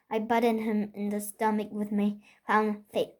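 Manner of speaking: plain reading voice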